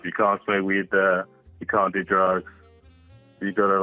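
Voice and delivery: ordinary talk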